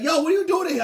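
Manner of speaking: high, loud, startled yell; retold shout. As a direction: shout at him when she's caught. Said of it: "She's like".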